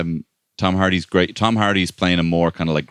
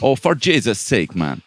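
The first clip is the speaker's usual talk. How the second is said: put-on high, mangled Irish accent drifting Jamaican; Helen Mirren's bad accent; 5 semitones higher than usual. Podcaster mocking her film accent, botched Irish-Jamaican impression. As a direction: imitate a terrible Irish-Jamaican accent, pitched up. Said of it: "trying to do Jamaican".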